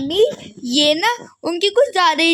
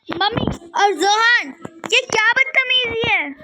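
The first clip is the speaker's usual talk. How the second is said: higher, sharp indignant demand; indignant outburst; 7 semitones higher than usual. Acted. Daughter confronting mother and brother, indignant and raised. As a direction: call them out in outrage.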